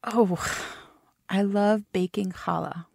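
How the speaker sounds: usual speaking voice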